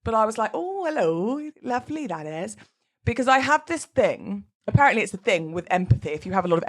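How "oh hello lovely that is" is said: lilting Welsh greeting, higher at the start; Welsh accent. Retold or imitated. Celebrant mimicking the Welsh wedding guests, Welsh accent. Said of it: "you mimic people's accents".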